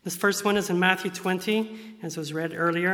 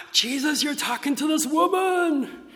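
high, loud shocked cry; 10 semitones higher, 5 dB louder than usual. Retold or imitated. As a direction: blurt it out scandalised. And they were shocked.

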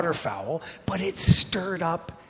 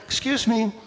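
higher, irritated "excuse me"; retold annoyance, pitched up; comic indignant interruption; mock mad; 7 semitones higher than usual. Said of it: "I'm getting really mad".

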